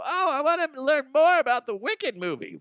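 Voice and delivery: high, excited mock child; a kid looking up the movie, in a high eager voice. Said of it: "they're gonna go".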